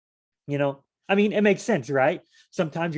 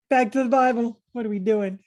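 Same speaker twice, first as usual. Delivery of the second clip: playful call; 9 semitones higher, 5 dB louder than usual. Real, not acted. Jokey return to reading.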